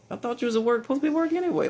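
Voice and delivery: high, whiny and rising; whiny accusation. Retold or imitated. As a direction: whine it like a sulky kid. Accusing Kylie, dumb guy.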